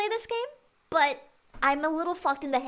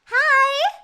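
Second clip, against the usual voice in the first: cheerful high greeting; 8 semitones higher, 6 dB louder than usual. Acted. Voicing Monika greeting.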